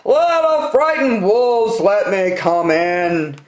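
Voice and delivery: a sly pig calling at the door; big bad pig. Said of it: "The pig rang the bell and said".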